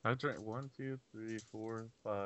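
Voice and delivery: put-on slurring like a drunk counting beers; getting drunker. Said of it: "I'm getting drunk".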